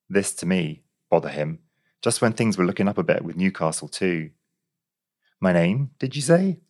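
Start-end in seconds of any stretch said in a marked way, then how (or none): 5.4-6.6 mildly meek query, lifting high at the end; innocent, higher-pitched stalling question. A narrator's reading. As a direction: ask meekly, as if playing for time. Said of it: "meekly clearing my throat".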